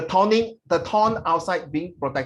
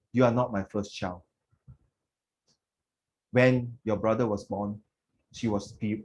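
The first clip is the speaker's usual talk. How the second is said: tearful emotional reading; 5 semitones lower than usual. Acted. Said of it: "tearing up because when I do all these things".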